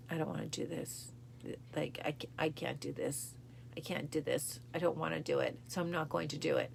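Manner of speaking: soft, hushed giving up; quietly broken, on the ground; defeated despair. Retold or imitated. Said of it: "me on the ground at that point going".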